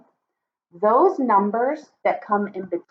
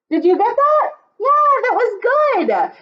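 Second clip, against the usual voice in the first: praise; 12 semitones higher, 3 dB louder than usual. Real, not acted. Praising children.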